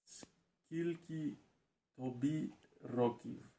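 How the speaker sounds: halting English-accented question; English accent Ukrainian. Real, not acted. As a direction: beginner reading aloud. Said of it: "So, read, please".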